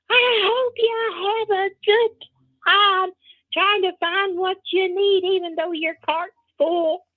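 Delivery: high, drawn-out called-out farewell; mock yelling across the store; retold loud yell. Man yelling after the shopper going round the corner, high and drawn out. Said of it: "he yelled out really loud".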